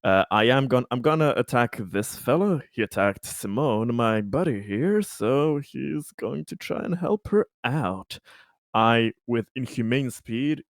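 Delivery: ordinary talk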